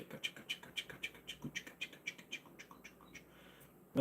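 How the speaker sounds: rapid-fire whispered chant; whispered repetition. Pretend. Streamer playfully repeating his acknowledgement of a comment, whispering. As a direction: keep repeating it in a tiny voiceless whisper.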